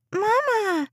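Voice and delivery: put-on high, whining little girl calling out for her mom; a child's shrill, whiny cry of Mama. A narrator's reading. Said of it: "a shrill, whiny voice".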